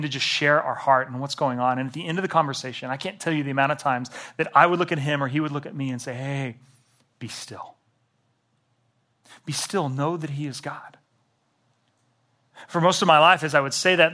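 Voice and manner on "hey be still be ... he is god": soft reassurance. Retold or imitated. Friend consoling over coffee.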